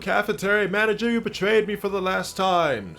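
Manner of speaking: high, loud, hammed-up outrage; dramatic shouted curse. [name] cursing the cafeteria manager who betrayed him, theatrical shout. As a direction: yell it like a melodramatic vow of revenge.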